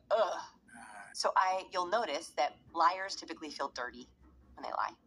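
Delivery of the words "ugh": short disgusted grunt; disgusted ugh. Shown as a demonstration. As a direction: let out a quick "ugh" of disgust. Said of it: "we go".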